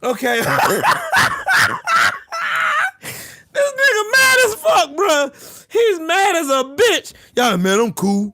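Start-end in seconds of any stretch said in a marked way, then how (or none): 0.5-3.2 high, helpless cackle; long breathless laughing fit; hard laughter. Real, not acted. Streamer laughs at mad rival, high helpless cackle. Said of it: "I ain't laughed this hard". 3.5-7.1 high, near-tearful glee; amused disbelief. Real, not acted. Streamer remarks on furious rival, high and near cracking up. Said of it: "I ain't laughed this hard".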